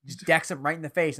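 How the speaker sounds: ordinary delivery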